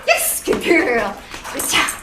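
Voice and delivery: loud burst of dog praise; punchy, gushing reward voice; really excited praise. Real, not acted. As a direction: mark it with an excited yes. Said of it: "that really excited yes sound".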